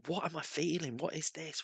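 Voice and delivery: high, hushed imitation of bewilderment; bewildered questioning. Businessman asking about his strange feelings, imitated bewilderment. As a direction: softly act out someone baffled by their feelings.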